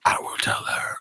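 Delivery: whispered threat; mock sinister whisper; menacing whisper. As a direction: whisper it ominously. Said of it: "they're like".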